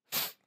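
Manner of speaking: TH as air blown between the teeth; isolated TH, just air; voiceless TH sound. Shown as a demonstration. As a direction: blow a bare TH, no voice. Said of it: "It's just air coming out".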